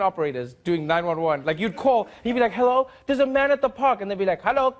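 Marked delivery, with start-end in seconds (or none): 2.5-4.0 high, loud caller; bright put-on caller phoning it in; mock caller voice. Caller reporting a man in the park, high mock voice. 4.4-4.7 high, loud operator voice; mock operator. Operator picking up the emergency call, high mock voice. South African operator answering.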